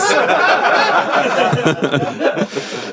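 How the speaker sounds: high, helpless laugh; burst of laughter. Real, not acted. Reader laughing at the story, bursting into laughter. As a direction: crack up at the absurd line.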